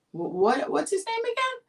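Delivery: playing dumb to hear it again; teasing dad. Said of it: "my dad was like".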